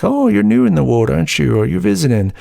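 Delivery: imitated chirpy welcome, pitch swooping up; imitating friendly greeter. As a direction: greet newcomers in a lifted, sociable voice. Imagined friendly greeting to newcomers.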